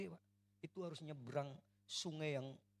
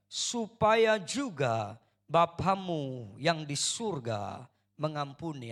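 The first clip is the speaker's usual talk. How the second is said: scripture read out together, much louder, slow; full-force congregational scripture reading; loud reading aloud; 5 semitones higher, 17 dB louder, slower than usual. Real, not acted. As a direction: declaim the verse to the whole hall. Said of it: "yuk baca, satu, dua, tiga".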